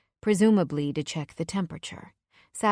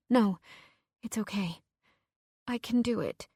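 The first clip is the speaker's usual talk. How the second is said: shaky and small; 4 semitones higher than usual. Acted. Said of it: "My voice comes out shaky and small".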